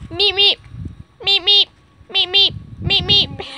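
high, loud little meep noises; perky high-pitched meep-meep; playful meeping. Pretend. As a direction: beep out paired squeaks. Playful squeaking beside the dogs.

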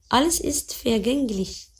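ordinary delivery

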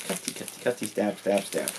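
soft gleeful chant while cutting; hushed murmur. Acted. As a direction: mutter it like a little villain. Playful cutting at box.

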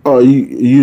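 ordinary talk, nothing marked

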